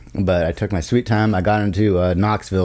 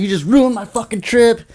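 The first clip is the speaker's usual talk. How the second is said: very high, raised-voice mock scream; retold screaming; 14 semitones higher, 4 dB louder than usual. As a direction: re-enact screaming blame at the driver. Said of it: "I'm, like, screaming at this poor lady".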